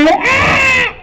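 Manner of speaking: sudden high cry of delight; gleeful witch shriek. Acted. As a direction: let out a witch's happy squeal.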